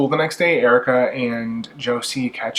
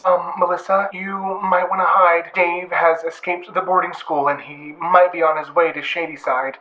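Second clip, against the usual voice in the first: worried mom; 4 semitones higher than usual. Retold or imitated. Dave's mom warns Melissa by phone.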